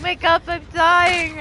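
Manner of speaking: high, loud and slow desperate plea. Real, not acted. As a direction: beg your teammate to wake up. Teammate downed, pleading.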